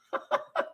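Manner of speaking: sudden squeaky laugh bursting out; high giggle burst. Real, not acted. Vlogger cracking up at her own joke, high giggle. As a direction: let a high giggle escape.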